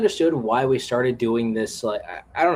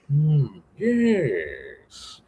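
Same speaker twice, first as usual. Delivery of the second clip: slow, drawn-out cartoon villain purr; Megatron villain voice; 3 semitones higher than usual. Shown as a demonstration. Showing friends his go-to comic villain, drawn-out villain voice. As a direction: savour it like a scheming villain. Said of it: "I tend to do this one villain voice".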